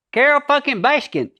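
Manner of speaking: high, loud, nasal "Carole fucking Baskin"; performative Joe twang. Shown as a demonstration. Actor demonstrates Joe's stage voice, high and nasal, loud. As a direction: belt it high in the sinuses like a showman. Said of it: "up in the nasal area".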